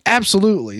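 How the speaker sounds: high, emphatic acted-out yes; retold enthusiastic agreement; eager acceptance. Acts out eager pizza acceptance.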